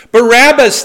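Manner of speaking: loud high shouted name; crowd shout. Acted. Preacher reading the crowd's answer to the governor, as a loud shout. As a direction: yell it like the mob choosing a prisoner.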